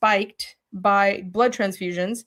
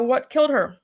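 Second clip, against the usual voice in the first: ordinary delivery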